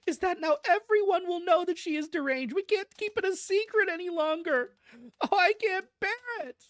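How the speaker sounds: very high, melodramatic lament; distraught wail. A narrator's reading. Mother fearing the secret is out, distraught wail. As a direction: wail as if it can't be borne.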